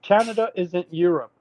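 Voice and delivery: normal talking voice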